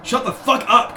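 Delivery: high, loud squealed outburst; mock squeal. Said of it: "Chris squealed".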